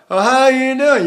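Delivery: high, loud regional dialect greeting; exaggerated American regional accent. Voice teacher imitating how people talk, regional accent. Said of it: "And people talk".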